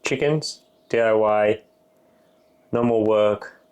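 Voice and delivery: ordinary talk, nothing marked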